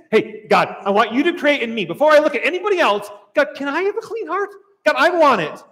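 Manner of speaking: loud, high, urgent plea to God; fervent prayer. Acted. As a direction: plead with God, voice lifted. Acted fervent personal prayer to God.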